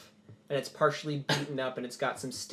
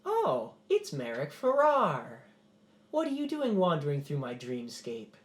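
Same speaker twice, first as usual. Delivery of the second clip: talking cat. Acted. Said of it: "looks up at your face and he goes".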